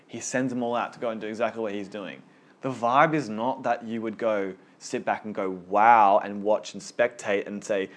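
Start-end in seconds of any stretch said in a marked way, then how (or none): 5.7-6.2 mock awe. Spectating in awe.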